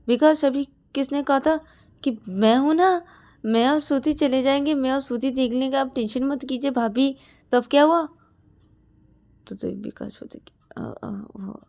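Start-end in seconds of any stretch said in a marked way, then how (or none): 0.1-8.0 loud, higher accusation; angry retort. Acted. Shreya throws Vikas's promise back at him, angry and loud.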